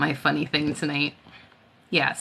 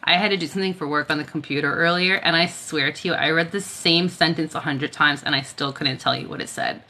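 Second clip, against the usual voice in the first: usual speaking voice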